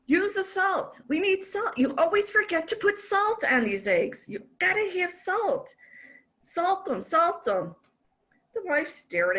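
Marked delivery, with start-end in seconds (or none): 0.1-7.8 high, fussy, panicky husband voice; frantic husband. Retold or imitated. Husband fussing at wife over frying eggs, high and panicky. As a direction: fret over the pan like a nervous husband. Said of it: "he says".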